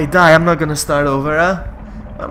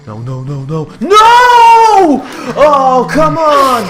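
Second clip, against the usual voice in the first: muted nos exploding into a high scream; frustrated screaming; 8 semitones higher, 11 dB louder, much slower than usual. Real, not acted. Player hit by enemy, screaming in frustration. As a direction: build suddenly to a loud, high wail. Yelling at game.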